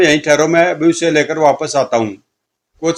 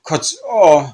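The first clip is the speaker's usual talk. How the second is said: imitated stab and cry; much slower than usual. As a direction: act a victim's pained cry. Stab sound and victim's cry.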